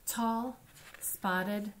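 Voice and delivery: usual speaking voice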